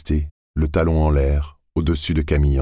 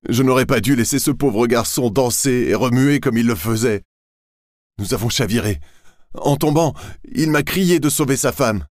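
high, distraught pleading; tearful lament; 8 semitones higher than usual. A narrator's reading. Laurent lying to the boatmen, tearful and distraught. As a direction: sob out the story of the accident. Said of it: "puis est revenu en pleurant".

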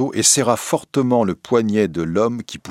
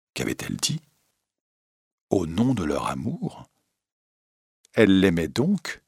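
ordinary delivery

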